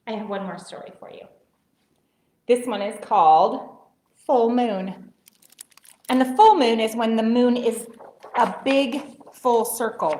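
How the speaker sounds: ordinary delivery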